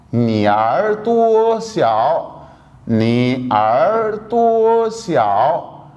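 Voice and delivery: 你耳朵小 very slowly, syllable by syllable; slow Chinese demo. Shown as a demonstration. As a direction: spell out 你耳朵小 slowly for students. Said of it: "ये बन जाएगा नी अर".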